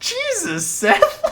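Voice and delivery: high outraged cry; scandalized high exclamation; shocked. Real, not acted. Shocked at hiding-game confession.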